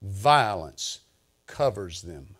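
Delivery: slow, weighty reading with long pauses; scripture reading. Real, not acted. Preacher reading Psalm seventy-three to the congregation, solemn measured recitation. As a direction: read the psalm deliberately, phrase by phrase.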